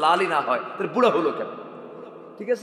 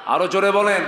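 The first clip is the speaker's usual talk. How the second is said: loud, raised call to the crowd; urging louder; 4 semitones higher, 7 dB louder than usual. Real, not acted. Preacher asking the congregation to answer louder, urging call. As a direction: push them to speak up.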